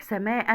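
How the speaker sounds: سماء ending in -an; تنوين بالفتح. Shown as a demonstration. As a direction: sound out سماء with a final -an. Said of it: "في التنوين بالفتح". (نطق سماء بفتحتين في آخرها)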